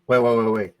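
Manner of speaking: ordinary delivery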